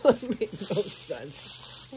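slow, laughing at yourself; amused at your own nonsense. Real, not acted. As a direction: let the laugh run into the words. Laughs at her own muddled remark.

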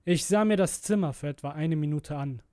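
usual speaking voice

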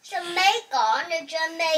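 loud put-on Jamaican accent. Doing the accent again on request, playful Jamaican accent. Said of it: "Do your accent again".

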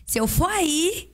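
hammed-up warning to a child; mock threat. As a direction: parent threatening the kid, mock stern. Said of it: "Diferente do tipo assim".

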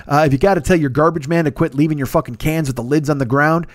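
usual speaking voice

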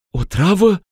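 high, shocked question; horrified gasp. Acted. Ruxanda horrified at the poison. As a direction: recoil at the word. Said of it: "strigă ea, înfiorându-se".

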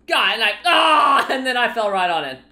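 loud, high, quick yelp of alarm; startled outburst. Real, not acted. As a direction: blurt it out in a fright. Nearly falling in game.

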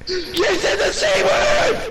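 very high-pitched excited yell; gleeful shouting. Real, not acted. Friend catching another saying the C word, high gleeful shout. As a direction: shriek it in triumph. Teasing outburst over a word.

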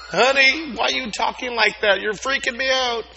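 high, loud freaked-out mother; alarmed mom. Pretend. Mom alarmed at child's strange voice, high frightened. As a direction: sound spooked like a mom.

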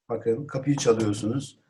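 usual speaking voice

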